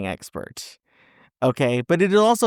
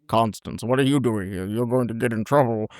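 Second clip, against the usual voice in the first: a light hint of an old film star, worried; Gregory Peck impression. Host imitating the hero at the door, lightly voiced warning. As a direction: lightly voice a leading man warning her. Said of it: "That's my Gregory Peck impersonation".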